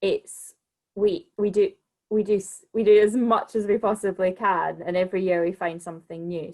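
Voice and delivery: normal talking voice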